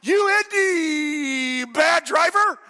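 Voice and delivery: high, loud, slow mock yell at a driver; road rage yell. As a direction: yell it at the car that cut you off. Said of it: "Somebody cuts me off, and".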